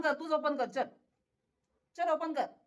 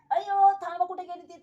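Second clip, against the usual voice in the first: alarmed call; 6 semitones higher, 8 dB louder than usual. Real, not acted. Cat climbs, owner calls out.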